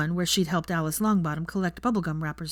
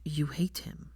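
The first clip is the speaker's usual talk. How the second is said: soft whisper; 2 semitones lower, 6 dB quieter, slower than usual. A narrator's reading. Draco quietly probing.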